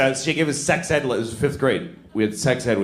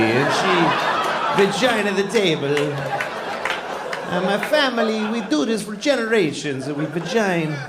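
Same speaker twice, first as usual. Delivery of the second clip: put-on higher-pitched stagey Italian accent; mock Italian accent; 7 semitones higher than usual. Italian craftsman boasting about the family's tables, mock Italian accent. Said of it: "nice old Italian table".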